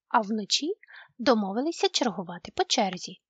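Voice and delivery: ordinary delivery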